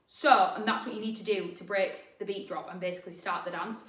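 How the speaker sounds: usual speaking voice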